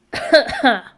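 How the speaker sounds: harsh choking cough; coughing up water. Real, not acted. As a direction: cough hard like something went down the wrong pipe. Says pesto makes her sick.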